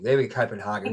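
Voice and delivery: ordinary delivery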